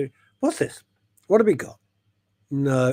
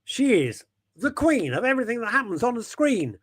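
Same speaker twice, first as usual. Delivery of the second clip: rhyming introduction; 7 semitones higher than usual. Pretend. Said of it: "I think that's probably a haiku".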